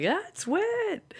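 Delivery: high, drawn-out breezy intro; cool intro. Shown as a demonstration. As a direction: sing out the name like a host.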